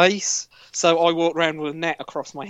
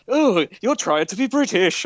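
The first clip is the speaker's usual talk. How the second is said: mimicking a British accent; 6 semitones higher than usual. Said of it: "you done it earlier with the girls".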